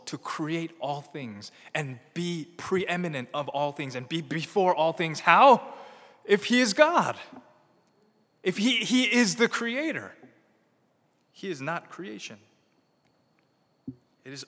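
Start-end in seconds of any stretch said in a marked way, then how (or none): 2.1-12.4 higher, louder preacher build; preaching at full force. Acted. Pastor building to a climax for the church, full-force preaching. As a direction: drive home that he is God.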